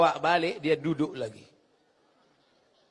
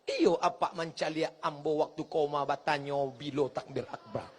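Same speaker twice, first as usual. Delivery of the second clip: Minang dialect; 3 semitones lower, 6 dB quieter than usual. Retold or imitated. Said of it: "Lalu saya tanya".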